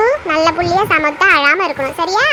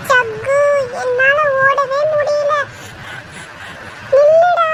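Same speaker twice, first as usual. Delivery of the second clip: put-on high little-kid voice calling out; high, childish whine; whining child; 6 semitones higher, slower than usual. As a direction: plead after a friend, high and drawn out. Child calls after running friend.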